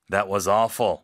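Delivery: That was awful said loud and harsh, like judging a failure; blunt loud verdict. Shown as a demonstration. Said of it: "이렇게 표현하시면 안 되고요".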